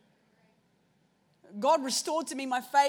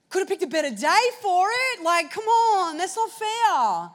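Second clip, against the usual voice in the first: louder, higher, slowing on not fair; whiny complaint; 5 semitones higher, 7 dB louder, slower than usual. Retold or imitated. Protesting to God at the cafe, loud whine. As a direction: moan it like it's unfair.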